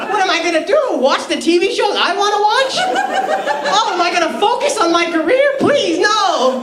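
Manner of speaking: high, hammed-up sarcastic wailing; mock distress. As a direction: badly fake despair at being left alone. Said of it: "did she think I'm gonna be like".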